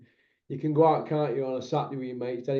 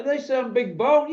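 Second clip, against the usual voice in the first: mock defensive; 8 semitones higher, 4 dB louder than usual. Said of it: "And I'd be like".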